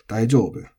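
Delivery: daijoubu peaking on jo, then falling; accent on JO. Shown as a demonstration. Teacher demonstrating nakadaka to learners, daijoubu accented on jo. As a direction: hit the jo in daijoubu and drop. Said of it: "with the accent on joubu".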